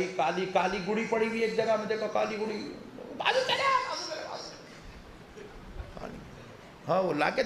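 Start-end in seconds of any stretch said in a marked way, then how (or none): 3.2-4.4 mock alarmed warning. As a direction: imitate a panicky "step aside" in a high voice. Acting out warning beside roadside doll.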